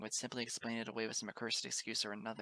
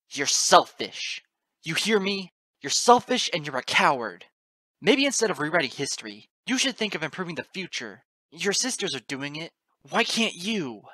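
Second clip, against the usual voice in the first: very loud, higher, furious rebuke; snarled accusation; 7 semitones higher, 14 dB louder than usual. A narrator's reading. Narrator calling the goddess a selfish coward, snarling. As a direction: snarl the charge at full force. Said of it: "I snarled".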